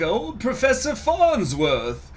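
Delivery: very high, slow old professor impression; high-pitched cartoon old man; Professor Farnsworth. Shown as a demonstration. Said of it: "Maybe like".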